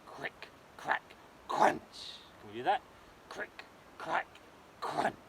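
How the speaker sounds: whispered crick crack then loud crunch; crunching sounds. Acted. Storyteller makes dragon crunching noises for children. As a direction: chomp like a hungry dragon.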